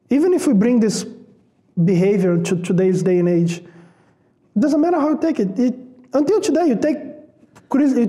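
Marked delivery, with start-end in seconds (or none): none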